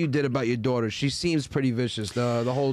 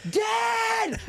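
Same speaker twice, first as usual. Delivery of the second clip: put-on high, sharp kid's yell; calling for dad. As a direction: imitate a child shouting through the house. Daughter calls out coming home.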